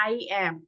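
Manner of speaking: "I am" spelled out as two separate words; careful written-style "I am"; wrong "I am". Shown as a demonstration. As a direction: bookish "I am". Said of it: "তখন আমি বলবো না".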